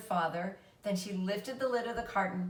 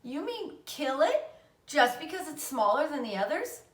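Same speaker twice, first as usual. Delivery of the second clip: high, pleading outrage; a child arguing hard to save the runt; upset protest; 6 semitones higher, 4 dB louder than usual. A narrator's reading. Fern begging her mother, upset and rising.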